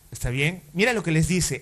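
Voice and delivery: ordinary talk, nothing marked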